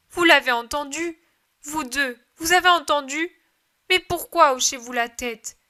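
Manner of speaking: desperate mother pressing the two men; urgent demand. Acted.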